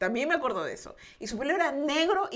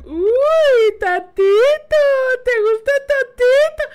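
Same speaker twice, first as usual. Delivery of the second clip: much higher and louder singsong tease; mock teasing; 13 semitones higher, 14 dB louder, much slower than usual. The family mocks the girl about Tato, loud singsong teasing. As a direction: taunt her about her crush, loud and sly.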